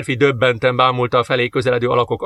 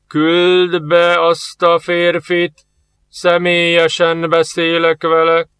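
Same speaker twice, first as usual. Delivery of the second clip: slow, louder, resounding order; commanding voice; 5 semitones higher, 4 dB louder, much slower than usual. Acted. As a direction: command from behind the wall. Hidden lord commands.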